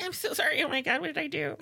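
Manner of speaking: very high mock-frantic voice; hammed-up squeaky remorse, playing the timid side; panicked apology. Acting out panicked split-personality apology.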